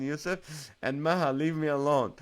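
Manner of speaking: ordinary delivery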